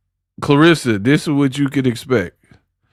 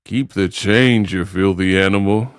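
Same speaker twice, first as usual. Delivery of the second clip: Home Alone gangster; 6 semitones lower than usual. Retold or imitated. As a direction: drag out the famous tough-guy line low. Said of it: "talking like that".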